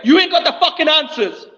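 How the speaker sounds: swaggering loud taunts. Acted. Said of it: "You're just screaming".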